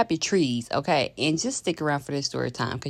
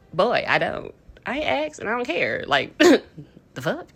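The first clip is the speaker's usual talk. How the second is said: ordinary talk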